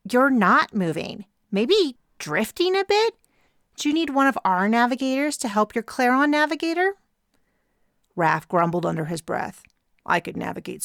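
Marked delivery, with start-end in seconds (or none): 0.1-6.9 sing-song condescension; taunting rival captain. Acted. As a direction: rival captain offering fake help, taunting.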